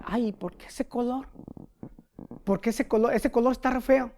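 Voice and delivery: mock the fussy lady fretting over the paint; whiny complaint. Señora se queja del color.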